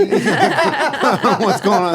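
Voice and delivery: giggling through the question; high, slow laughing words. Real, not acted. Laughing at adult books sign.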